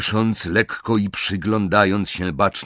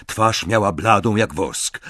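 ordinary delivery, nothing marked

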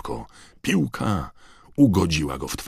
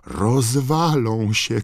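put-on high, drawn-out girl's cry; alarmed cry; 8 semitones higher, 4 dB louder, much slower than usual. A narrator's reading. Hermiona sees two seekers diving at the ground, crying out. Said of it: "krzyknęła Hermiona".

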